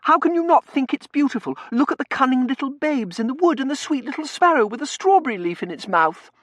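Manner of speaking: very high, loud gushing protest; excitable young lady's outburst; exasperated Tossie. Acted. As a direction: plead indignantly at top pitch.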